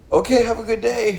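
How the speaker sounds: high-pitched, awkward sign-off; mock chipper goodbye. Awkward escape from crying teacher.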